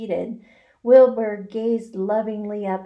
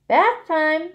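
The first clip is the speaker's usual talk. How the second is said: higher, louder and bright; cheerfully; 9 semitones higher, 7 dB louder than usual. A narrator's reading. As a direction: announce it like a treat. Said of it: "said Zuckerman cheerfully".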